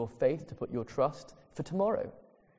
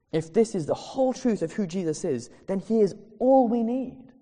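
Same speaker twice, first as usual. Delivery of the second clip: higher and louder, building to a preaching peak; rising preaching; 5 semitones higher, 4 dB louder than usual. Acted. Pastor building to the sermon's climax, rising preaching. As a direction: preach it with growing conviction.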